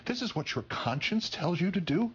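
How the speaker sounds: high-pitched, disbelieving question; incredulous challenge. Real, not acted. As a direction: challenge them in rising disbelief. Rhetorical challenge to opposing voters.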